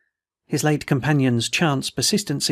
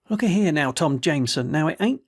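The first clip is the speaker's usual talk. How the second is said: usual speaking voice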